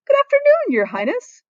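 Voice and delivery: very high, loud and bright greeting; cheerfully hailing the prince; cheery greeting. Acted. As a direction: sing out the hello to the prince. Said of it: "Shuga said cheerily".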